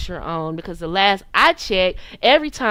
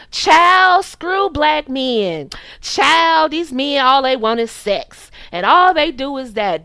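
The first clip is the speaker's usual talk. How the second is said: loud, higher sassy venting to the audience; mock man-bashing rant; 4 semitones higher, 5 dB louder, slower than usual. Pretending to fire up her channel and trash men, mock rant. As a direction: imitate a fed-up woman bad-mouthing men.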